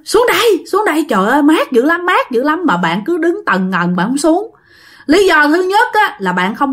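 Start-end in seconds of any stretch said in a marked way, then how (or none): 0.1-2.6 1 calling out. Acted. Sinh calls from the pond.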